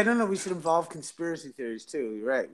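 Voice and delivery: ordinary talk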